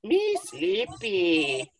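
very slow toy voice; mock Furby voice, slowed right down; sleepy Furby. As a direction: stretch it out like a drowsy toy. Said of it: "but it was so weird".

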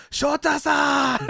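high, long-drawn mock holler; imitated scream. Host imitating wrestlers screaming at a teammate, drawn-out yell. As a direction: call the name high and stretched, hamming it up. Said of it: "they're, like, screaming at him".

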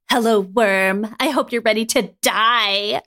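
slow, drawn-out villain menace; sadistic hissing. A narrator's reading. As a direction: savour the threat, very slowly. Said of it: "Viper hissed with a sadistic".

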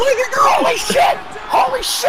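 excited scream. Real, not acted. Surprise run-in, screaming.

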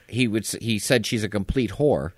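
ordinary delivery

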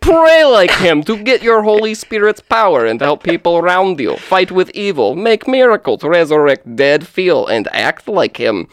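hammed-up bad Russian accent. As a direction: read it in a terrible Russian accent. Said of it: "do a terrible Russian accent".

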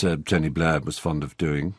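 usual speaking voice

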